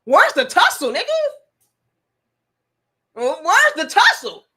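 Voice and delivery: loud, high indignant challenge; demanding a fight. Retold or imitated. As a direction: call him out for a fight. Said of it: "I'm like".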